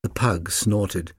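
plain reading voice

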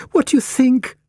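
high-pitched eccentric lady with news; high, eager character voice; excited Cat Lady. A narrator's reading. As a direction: ask eagerly in a high lady's voice. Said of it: "went on the Cat Lady".

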